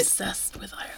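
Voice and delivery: unvoiced, soft aside; whisper. Real, not acted. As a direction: whisper it like a secret.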